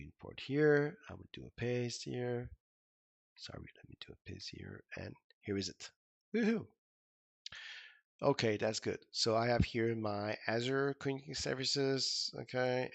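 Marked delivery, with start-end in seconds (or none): 6.3-6.7 short, high, loud cheer; bright little victory cry; celebratory whoop. Real, not acted. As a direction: let out a quick happy woohoo. Celebrates a finished step.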